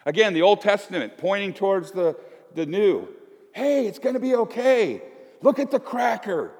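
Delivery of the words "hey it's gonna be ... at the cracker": cheery reassurance. Pretend.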